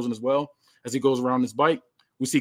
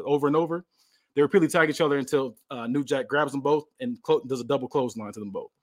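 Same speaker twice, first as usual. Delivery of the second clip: ordinary delivery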